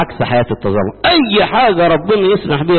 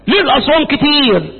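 whiny mock complaint; 9 semitones higher than usual. Mocking a complainer.

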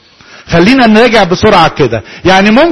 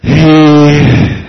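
drawn-out low heh sound, very slow; teacher demonstrating a startling wordless heh; demo wordless sound; 5 semitones lower, much slower than usual. Shown as a demonstration. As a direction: stretch a low wordless heh to show a sound without words. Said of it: "ويلاقي فيه حد عمل كده".

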